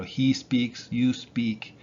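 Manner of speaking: usual speaking voice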